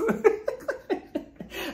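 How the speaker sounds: high breathy laugh. Real, not acted. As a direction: laugh in disbelief. Laughing at Drew.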